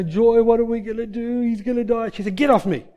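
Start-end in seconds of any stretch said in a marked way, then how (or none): 0.0-2.1 high, loud imitation of panicked relatives; mock panic. Panicked relatives crying to Joy. 2.4-2.9 loud, high imitated rebuff; sharp rebuff. Joy shakes off clinging relatives.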